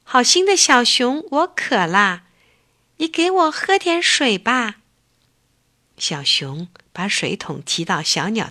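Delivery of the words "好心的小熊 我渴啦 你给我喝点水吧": a little bird asking for water, higher and pleading; a tired bird begging, voice lifting; weak little bird. Acted. Thirsty little bird begs the bear for water, mild weary plea. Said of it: "小鸟有气无力地说".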